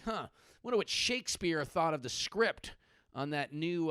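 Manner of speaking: ordinary talk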